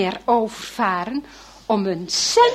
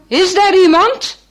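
a man calling into the storm, raised voice; 7 semitones higher, 9 dB louder than usual. Acted. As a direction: call out from the hut door. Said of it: "een zware mannenstem roept".

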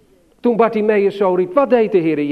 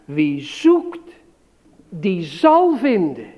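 slow recited verse; slower than usual. Shown as a demonstration. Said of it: "Dat moet je maar goed vasthouden".

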